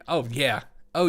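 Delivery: ordinary delivery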